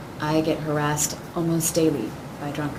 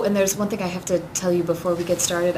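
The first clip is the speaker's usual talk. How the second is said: normal talking voice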